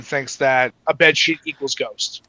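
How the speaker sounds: ordinary talk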